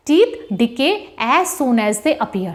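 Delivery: very slow, spelling out each word; slow dictation. Shown as a demonstration. Teacher dictates the rubric to students, very slow. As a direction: read it out slowly so students can write.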